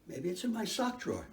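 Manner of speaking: normal talking voice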